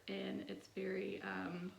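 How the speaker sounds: ordinary delivery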